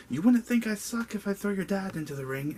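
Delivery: hammed-up retort, playing the villain; mock heel comeback. Heel wrestler snapping back at a kid, mock villain taunt. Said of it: "And Matt Morgan was like".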